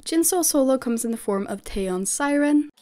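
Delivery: normal talking voice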